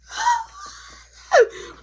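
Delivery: high, loud sobbing gasp; panicked crying gasp. Retold or imitated. Panicking sibling can't reach help, crying gasp.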